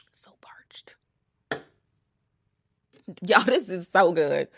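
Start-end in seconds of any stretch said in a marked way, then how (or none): 0.1-1.0 whispered, soft and dry; hushed thirsty sigh; parched whisper. Real, not acted. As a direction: breathe it out after a sip. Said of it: "let me just take a sip".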